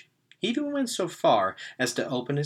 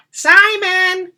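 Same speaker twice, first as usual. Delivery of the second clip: grandma calling; 15 dB louder than usual. A narrator's reading.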